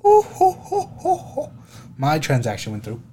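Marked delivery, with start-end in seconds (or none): 0.0-1.5 very high falsetto "ho, ho, ho"; gloating hoots. Real, not acted. Gloating over a transfer, high falsetto hoots. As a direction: crow in short high hoots.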